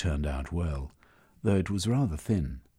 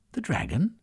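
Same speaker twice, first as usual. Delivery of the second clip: surprised; 9 semitones higher than usual. Acted. Surprised question.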